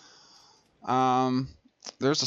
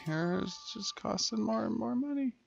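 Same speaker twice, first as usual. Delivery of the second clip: higher, softer whining about money; whiny complaint; 10 semitones higher, 7 dB quieter than usual. Real, not acted. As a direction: whine it softly, pitched up. Repeats the cost complaint, whinier.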